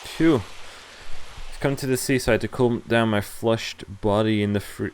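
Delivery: ordinary delivery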